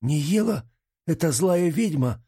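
high, startled disbelief; incredulous question. Acted. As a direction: ask it as if you can't believe it. Startled question about the named girl.

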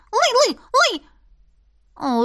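slow, high, drawn-out barks; barking. A narrator's reading. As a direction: bark it high and stretched. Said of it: "снова получилось".

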